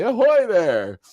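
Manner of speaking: high, loud jaunty call; hammed-up hail. Host mimics Ruckus strutting up to the boat, hammed-up shout. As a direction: sing out a showy greeting across the water.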